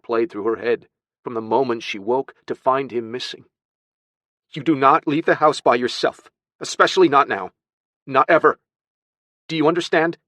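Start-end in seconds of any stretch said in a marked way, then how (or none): 4.5-10.2 emphatic, raised-pitch telling-off; loud, firm warning; stern scolding. Acted. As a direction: hammer the rule home to a child. Aunt scolds boy sternly.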